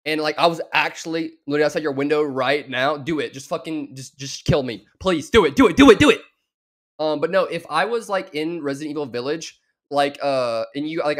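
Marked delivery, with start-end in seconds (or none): 5.0-6.2 pleading that builds to a loud, high yell; escalating begging shout. Pretend. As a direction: start urgent and end screaming it out.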